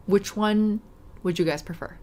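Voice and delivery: ordinary delivery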